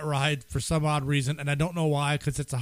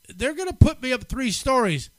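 ordinary delivery

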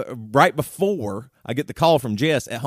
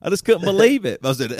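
ordinary delivery

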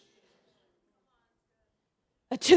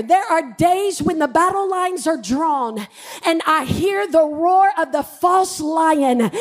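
ordinary talk

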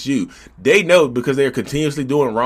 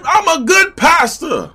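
high, loud, slow self-righteous claim; hammed-up high, loud pious pastor voice; mock pious pastor; 14 semitones higher, 5 dB louder, slower than usual. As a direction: proclaim it with fake piety.

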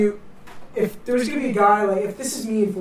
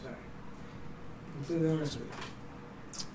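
imitating a very soft-spoken teacher, much softer; quiet professor; 3 semitones lower, 14 dB quieter than usual. Professor opening first class, imitated very quietly. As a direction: drop way down, mild mock mumble. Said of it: "Very quiet guy".

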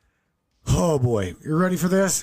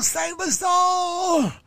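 higher, very slow hammed-up shout of the plea; mock wailing shout; much slower than usual. Older lady pleading again, hammed-up high slow shout. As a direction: wail "Save us all" at the top of a mock voice. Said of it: "She's like".

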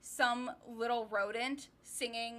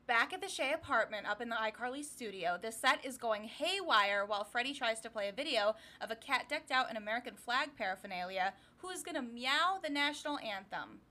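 ordinary delivery

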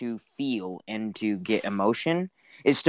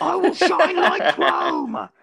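very high, slow, laughing shout; high slow cackling war cry; Mad Max war boy; 9 dB louder, much slower than usual. Acted. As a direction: scream it like a crazed war boy.

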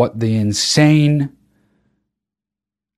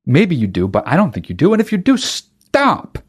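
ordinary delivery

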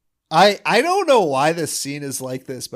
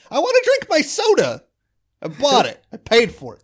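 a jump high and loud, then settling; playful insistence; 8 semitones higher, 4 dB louder than usual. Real, not acted. Speaker joking about stuck soda tabs, insistent then easing. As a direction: demand it like a cheated customer. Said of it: "insisting on opening the soda".

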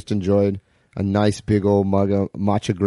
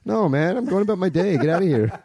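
dismissive dude voice; 7 semitones higher than usual. Pretend. Acted bit continues.

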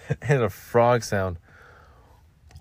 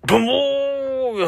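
ordinary talk

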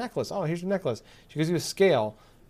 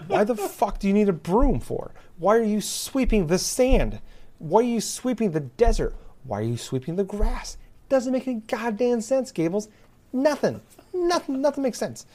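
high-pitched, incredulous griping; worked-up comic outrage, pitch jumping high; exasperated rant; 7 semitones higher than usual. Real, not acted. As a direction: demand an answer to something senseless. Ranting about sweeping sand.

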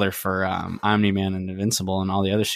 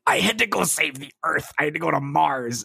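higher, exasperated hero voice; indignant Mark; 6 semitones higher than usual. Retold or imitated. Mark defending himself to Amber, higher pitch. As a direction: plead like a wronged boyfriend. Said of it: "He's like".

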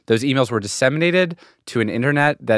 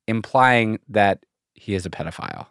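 usual speaking voice

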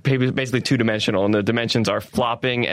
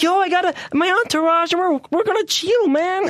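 bragging about the entourage, pitched way up; Neymar as American. Retold or imitated. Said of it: "if he was American, he'd just be like".

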